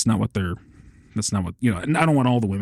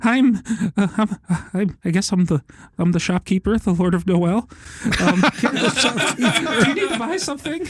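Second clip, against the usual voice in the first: high, stammering mock-nervous voice; nervous shopkeeper; 10 semitones higher, slower than usual. Shown as a demonstration. Shopkeeper greeting customers in game, high nervous stammer. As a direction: hesitate and squeak, unsure of yourself. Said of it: "the nervous people".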